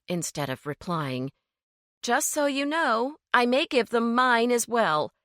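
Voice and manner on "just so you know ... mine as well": teasing provocation; goading taunt. A narrator's reading. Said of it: "he heard her taunt".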